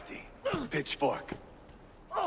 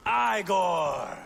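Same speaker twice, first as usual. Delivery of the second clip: calling out; 5 semitones higher, 5 dB louder than usual. Acted. Calling out across the hall.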